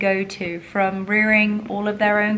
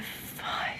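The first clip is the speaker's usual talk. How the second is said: whispered swear; 11 dB quieter than usual. Retold or imitated. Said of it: "I'm like".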